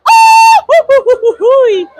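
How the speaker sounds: loud high cartoon hoo-hoo laugh; Goofy laugh. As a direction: whoop it out like a goofy cartoon dog.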